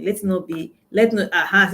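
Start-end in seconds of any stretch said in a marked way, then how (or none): none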